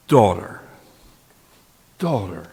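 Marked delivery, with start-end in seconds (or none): 2.0-2.5 soft echoed word. Shown as a demonstration. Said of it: "he calls the woman and the young girl".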